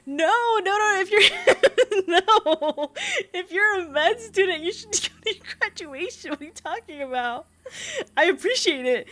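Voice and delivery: high, loud, incredulous objection; laughing protest. Real, not acted. Streamer tells med student to attend graduation, incredulous.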